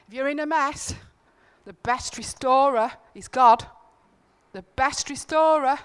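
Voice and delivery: preaching emphasis. Real, not acted. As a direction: drive the point home to the room. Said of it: "preaching climax".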